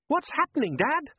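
put-on high frightened boy's voice; a scared son pleading. A narrator's reading. Son Les asking his father in fear, high frightened boy voice.

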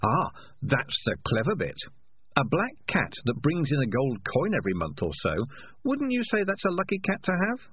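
a cat proud of his trick; clever cat. Acted. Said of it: "said the conscience".

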